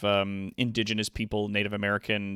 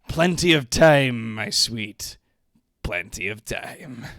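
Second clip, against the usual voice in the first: lusty lord voice; 6 dB louder than usual. Acted. Streamer reading the lord's lines, slow and suggestive. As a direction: purr it like a sleazy nobleman. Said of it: "I'm playing both the characters".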